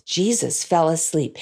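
ordinary delivery

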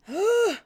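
loud, high gasp of horror; sharp gasp. Retold or imitated. Retelling the spider moment, a loud shocked gasp. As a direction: suck in air in sudden shock. Recalling panic over the spider.

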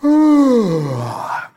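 loud exaggerated bored sigh; mock bored sigh down the phone. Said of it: "on the other end goes".

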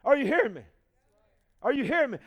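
normal talking voice

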